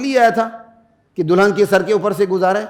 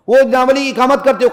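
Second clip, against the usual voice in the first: ordinary talk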